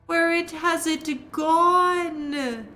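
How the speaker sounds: high, slow, wailing ghost woman; wailing wraith. Acted. Wraith shrieking at the intruder, high wailing moan.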